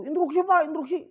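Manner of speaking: high, loud butting-in; pushy interjection. Shown as a demonstration. Teacher shows pupils how not to interrupt, pushy and high. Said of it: "jangan begitu".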